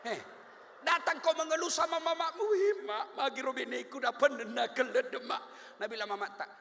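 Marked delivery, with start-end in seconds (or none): 2.4-5.8 whiny son to mother. Retold or imitated. Son whining to his mother.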